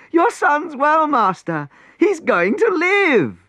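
very high, delighted voice; joyful news. Acted. Servants telling the prince his son lives, overjoyed. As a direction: burst out with the happy news.

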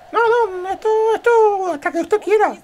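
very high squeaky put-on voice; obsequious underling. Underling answers the leader about endless applause, high servile squeak.

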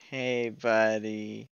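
loud, slow drawn-out hey buddy; drawn-out call. Acted. Player calls to the figure in the dark, drawn out. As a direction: stretch the greeting out loud and slow. Calling out to a game character.

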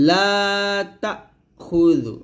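long লা, short তা, stop, then খুযু; break after ta. Shown as a demonstration. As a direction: recite তাখুযু slowly with a clean catch after "ta". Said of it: "তা বলে একটু break করবেন". (তা বলে থেমে খুযু বলা)

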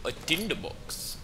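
put-on botched British accent that drifts toward Indian; badly faked British, Indian-like lilt; British accent attempt sounding Indian. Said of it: "Sound fucking Indian".

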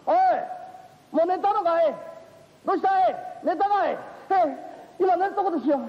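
uncle calling upstairs. Acted. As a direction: call up to the young ones teasingly. Uncle calls up the stairs.

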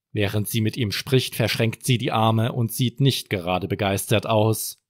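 plain reading voice

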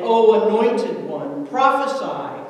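loud mocking call. A narrator's reading. Captors taunting blindfolded Jesus, mocking. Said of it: "They mocked him".

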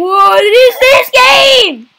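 slow, high screaming cry; terrified scream. Real, not acted. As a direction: scream in real horror. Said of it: "Why are you screaming so loud?".

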